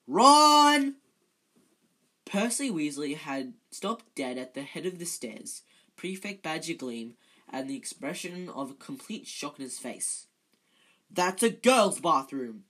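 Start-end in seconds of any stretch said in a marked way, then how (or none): 0.1-1.0 sudden high, booming yell; loud shout. A narrator's reading. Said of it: "a loud voice made all three of them jump". 11.1-12.6 loud, slow, shocked exclamation; gasped. A narrator's reading. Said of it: "he gasped".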